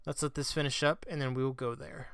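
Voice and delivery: usual speaking voice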